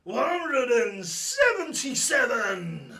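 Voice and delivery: slow, loud, high caller drawl; darts caller call. Acted. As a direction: stretch it out like the MC on stage. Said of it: "Could I have a one seven seven".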